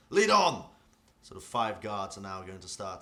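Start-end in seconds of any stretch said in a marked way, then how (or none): 0.1-0.7 high, very loud shouted order; rally the men with a shout; commander's battle cry. Acted. Commander pushing his men across, shouted battle cry. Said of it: "draws his sword and says".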